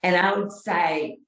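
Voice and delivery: ordinary delivery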